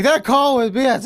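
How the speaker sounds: loud, high griping at the umpire; hammed-up protest of a call; mock complaint. Player complains to the big umpire, loud mock gripe. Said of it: "it's like".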